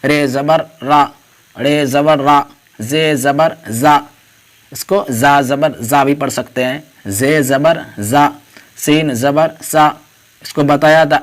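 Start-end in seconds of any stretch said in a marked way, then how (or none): 0.0-4.0 each letter name, then zabar, then the vowel sound, spaced out; letter-by-letter drill. Shown as a demonstration. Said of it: "دیکھیے آ کی آواز نکل رہی ہے". 7.2-9.9 each letter name, then zabar, then the vowel sound, spaced out; letter-by-letter drill. Shown as a demonstration. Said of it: "دیکھیے آ کی آواز نکل رہی ہے".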